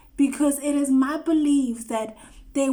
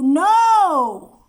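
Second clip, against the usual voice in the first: loud, high, forceful no; emphatic shout; 5 semitones higher, 10 dB louder than usual. Real, not acted. Loud cry of no to those who romanticize struggle. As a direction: shout the refusal.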